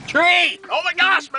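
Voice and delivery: high-pitched cry of alarm; alarmed yell. Real, not acted. Passenger warns driver of a tree, alarmed yell.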